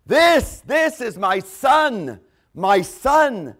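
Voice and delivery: loud, high, booming proclamation; voice from heaven. Acted. Voice from the cloud declaring his Son, loud proclamation. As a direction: hammer out each word, stretched and forceful. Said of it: "the voice came out and says".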